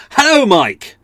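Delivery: exclamation mark shout. Shown as a demonstration. Mocking how friends text him, shouted. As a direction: bark the greeting like it ends in an exclamation mark. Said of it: "That's not "Hello, Mike," it's".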